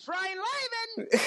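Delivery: very high, loud cartoon cry; cartoon catchphrase voice. Shown as a demonstration. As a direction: shriek it out, zany and squealing. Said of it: "then to Mister Rugg's attempt".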